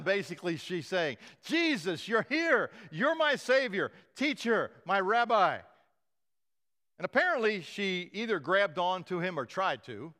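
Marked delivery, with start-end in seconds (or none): 1.4-5.6 higher and louder, overjoyed; joyful cry. Retold or imitated. Mary cries out to Jesus, higher and overjoyed. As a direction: pour out relief and joy. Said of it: "cried out".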